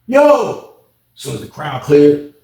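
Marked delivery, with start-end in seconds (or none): 0.1-0.7 high, loud answering shout; calling back. Retold or imitated. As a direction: holler back to whoever called. Calls back in the story.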